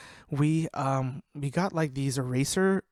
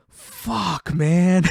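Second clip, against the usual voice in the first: higher, swelling to a loud groan of defeat; mock exasperation; 4 semitones higher, 7 dB louder than usual. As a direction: imitate a friend who knows he's been tricked. Acting out tricked friend's dismay.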